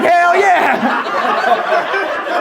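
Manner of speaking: laughing high-voiced mamaw; old lady cheer. Old ladies in crowd voiced.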